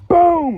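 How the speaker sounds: loud, high boom; shouted impact sound effect; imitated crash. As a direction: blast out the smash, high and loud.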